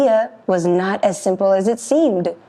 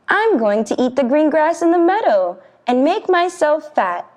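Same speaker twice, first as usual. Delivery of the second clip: high goat voice; 7 semitones higher than usual. Acted. Little goat to troll.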